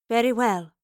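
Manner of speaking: plain reading voice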